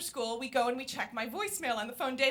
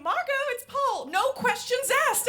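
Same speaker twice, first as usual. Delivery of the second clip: a high, slow mock voice for the caller; 11 semitones higher, 5 dB louder than usual. Mother mocking Paul's name in a high, slow voice.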